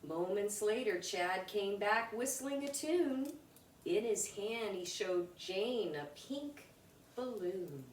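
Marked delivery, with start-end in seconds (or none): none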